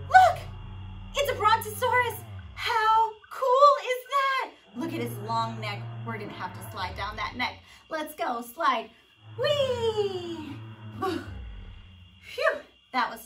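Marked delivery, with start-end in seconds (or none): 0.1-4.5 high, loud cry of wonder; gasp, then exclaim as if spotting something incredible; thrilled playful shouting; amazed excitement. Pretend. Spots a pretend brontosaurus. 9.4-10.6 long, high, falling "whee"; playful sliding cheer; gleeful whee. Pretend. Sliding down dinosaur neck.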